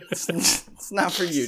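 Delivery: breaking into a laugh mid-line; retelling telling the dog off, cracking up. Retold or imitated. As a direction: let the laugh spill through the words. Repeating while laughing.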